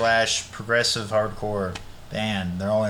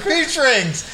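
high-pitched mock-eager blurt; emphatic answer; 12 semitones higher than usual. Friend answering the candy question, emphatic. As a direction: pitch leaps up as if the answer is obvious.

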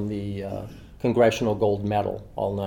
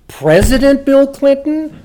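much higher, louder and slow, stunned question; dumbfounded echo; 11 dB louder, slower than usual. Retold or imitated. Author hearing Jackie name the president, high stunned disbelief. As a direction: play yourself as slow on the uptake, gasping the name. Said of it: "Mister Quick on the uptake".

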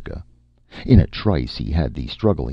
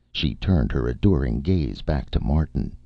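usual speaking voice

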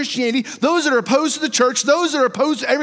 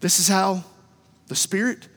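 ordinary delivery

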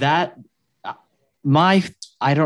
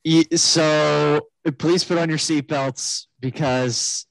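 higher, faltering captain announcement; nervous pilot; 5 semitones higher, 4 dB louder, slower than usual. Pretend. Imagining an anxious pilot on the PA, higher voice. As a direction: sound like a pilot unsure of himself. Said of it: "can you imagine?".

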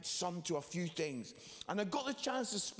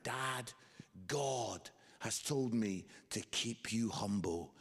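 low, slow, measured pronouncement; solemn son; 8 semitones lower, slower than usual. Retold or imitated. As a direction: deliver it low and slow like a prophecy. Son solemnly answers his dad back.

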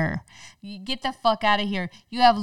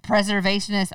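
ordinary talk

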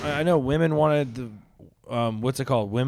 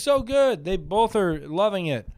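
high, loud gushing approval; mock enthusiasm; 10 semitones higher, 4 dB louder than usual. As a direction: act out an over-keen viewer. Mock reaction to watching porn.

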